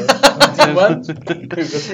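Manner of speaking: very slow, stretched, laughing; drawn out. Acted. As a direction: drag the word out teasingly. Mocking the host's catchphrase. (arrastado e a rir)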